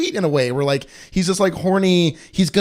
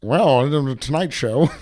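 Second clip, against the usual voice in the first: goat Johnny Carson. Pretend. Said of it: "do Johnny Carson".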